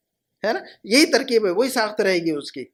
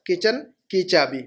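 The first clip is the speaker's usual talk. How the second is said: normal talking voice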